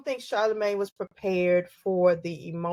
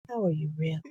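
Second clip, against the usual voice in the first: gentle interviewer; 5 semitones lower, 4 dB quieter, faster than usual. Retold or imitated. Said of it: "she would do the same thing".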